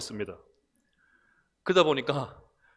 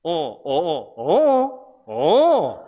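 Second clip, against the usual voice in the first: imitating Gyeongsang pitch swings on lone syllables; Gyeongsang intonation; 2 semitones higher, 6 dB louder, much slower than usual. Shown as a demonstration. Pastor mimicking a baffling dialect test, Gyeongsang intonation. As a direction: mimic the loud, swooping tones of Gyeongsang dialect. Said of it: "억양이 뭐".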